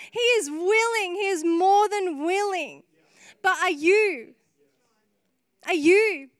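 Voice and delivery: building to high, forceful preaching; impassioned preaching. Real, not acted. Preacher urging the church that God is willing, impassioned. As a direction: drive the challenge home to the congregation.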